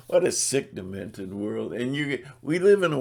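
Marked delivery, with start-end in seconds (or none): none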